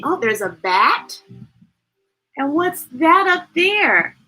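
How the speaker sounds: usual speaking voice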